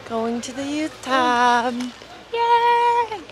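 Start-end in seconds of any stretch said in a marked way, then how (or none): none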